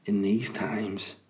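slow, hushed whisper. Real, not acted. Whispering an aside about these times. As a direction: let it drift out voiceless and slow.

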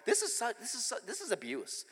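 hushed, dramatic mock-victim voice; quietly wronged child, retold; aggrieved inner thought.

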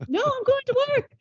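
high mock Scottish friend; Simon's reply. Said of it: "And he's like".

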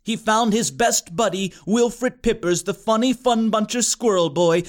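ordinary delivery, nothing marked

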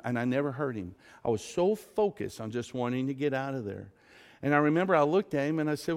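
ordinary talk

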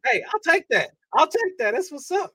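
high, proud, happy; high pleased acceptance; pleased reply. Retold or imitated. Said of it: "I'm like".

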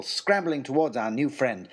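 plain reading voice

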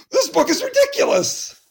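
high, fast outraged complaint; exasperated squeal. Pretend. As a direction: rattle it off, high and indignant. Reader exasperated.

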